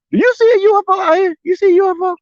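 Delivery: mock disbelief. Mocking UFO claims to viewers.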